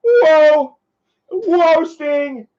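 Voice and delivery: high, loud sitcom catchphrase whoa; Joey Lawrence whoa. Retold or imitated. Streamer imitating the sitcom star's catchphrase, loud high whoa. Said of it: "How's that old Joey used to say".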